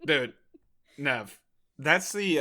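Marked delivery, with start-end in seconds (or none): none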